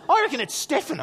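put-on high-pitched kid's verdict; decided child. Son choosing a pronunciation for dad, high and sure. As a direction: play a son making up his mind.

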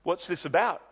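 normal talking voice

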